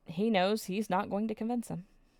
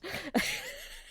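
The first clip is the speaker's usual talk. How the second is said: squeaky breathless laugh up high; a high disbelieving giggle; giddy squeal of a laugh; 5 dB quieter than usual. Real, not acted. Emotional recap, laugh after.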